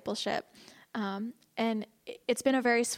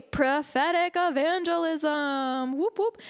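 slow, drawn-out, higher-pitched fanfare; playful announcement; 5 semitones higher, 3 dB louder, much slower than usual. Pretend. Teacher introduces the next topic to class, playfully hyped. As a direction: stretch out the title, then whoop high.

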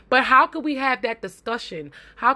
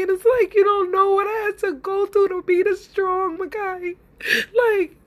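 higher, tearful pleading to her son; crying; 7 semitones higher than usual. Real, not acted. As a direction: speak through real tears to him. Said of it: "you guys have me up here, crying".

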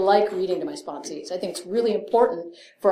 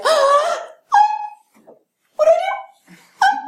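high, louder hammed-up panic; panicked gasps; 5 dB louder than usual. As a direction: act out startled dismay, pitch shooting up. Panicking after sponsor walked out.

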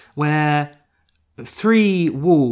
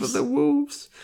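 high mock voice; 12 semitones higher, 4 dB quieter than usual. Continuing the bit.

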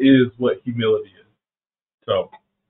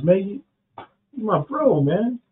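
pitched-up casual bro reply; Peter's casual answer; 9 semitones higher, slower than usual. Retold or imitated. Pastor voicing Peter answering Jesus, high and casual. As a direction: brush it off high like a buddy. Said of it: "Peter says".

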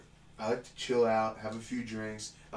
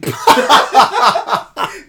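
shrieking burst of laughter; loud high laugh; 13 semitones higher, 19 dB louder than usual. Real, not acted. Laughing at the fat boy insult, loud high laugh.

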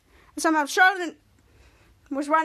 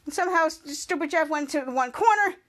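ordinary delivery, nothing marked

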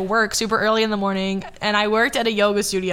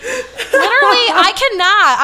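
high, loud exasperated cry with a laugh; fed-up shriek at a friend; exasperated outburst; 10 semitones higher, 5 dB louder than usual. Retold or imitated. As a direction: throw up your hands in disbelief. Exasperated quote of self at friend.

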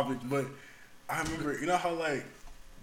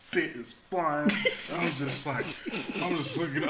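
usual speaking voice

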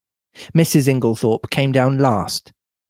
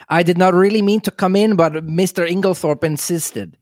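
put-on higher-pitched mad scientist with European accent; Doctor Bauerstein; 5 semitones higher than usual. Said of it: "European mad scientist".

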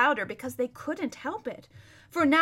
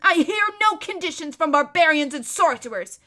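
loud, raised, haughty refusal; scornful defiance; 5 semitones higher, 8 dB louder than usual. Acted. Captive prince to the king, angrily defiant. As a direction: spit back the terms with contempt. Prisoner rejects the king's terms.

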